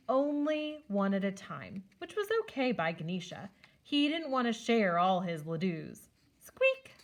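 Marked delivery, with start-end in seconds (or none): 6.4-6.9 high, sharp little squeak; playful high-pitched squeak; mouse squeak. A narrator's reading. Narrator adds mouse sound.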